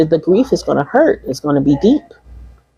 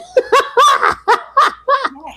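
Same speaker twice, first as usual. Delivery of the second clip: high burst of laughter. Real, not acted. Laughing at herself for avoiding tears. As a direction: laugh it off after joking about tears. Laughs after crying joke.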